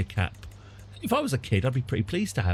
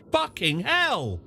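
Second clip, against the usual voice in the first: real angry outburst, a touch higher and drawn out; genuine rage; 7 semitones higher, slower than usual. Real, not acted. Player cursing at a bad game event, real rage. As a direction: swear it out in real frustration at the game. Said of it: "That was genuine rage".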